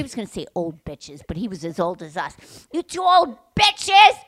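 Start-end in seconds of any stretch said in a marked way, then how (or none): 2.7-4.2 slow, high, loud mock yell; screaming insult. Imagined version of the man's insult, slow angry shout. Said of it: "as he's screaming at us".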